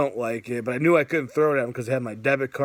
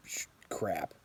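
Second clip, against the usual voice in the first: hushed self-censored curse; 6 semitones lower, 11 dB quieter than usual. Real, not acted. Catches himself swearing.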